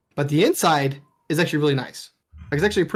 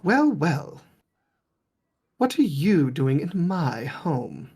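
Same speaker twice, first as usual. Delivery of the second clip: slow, haughty noblewoman, lightly voiced; lady of the house; slower than usual. Lady Wachter questioning the intruder, slow and haughty. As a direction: draw it out like a suspicious aristocrat. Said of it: "And she says".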